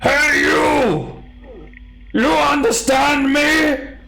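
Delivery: very high, slow, loud astonished giant cry; giant heads in unison. Acted. Two heads shouting together that she speaks giant. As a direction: shout it in dumb delight.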